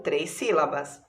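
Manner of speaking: ordinary talk, nothing marked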